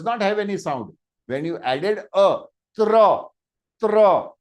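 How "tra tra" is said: tra said loud and clear; demo tra. Shown as a demonstration. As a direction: punch out the syllable tra. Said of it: "did you observe tra".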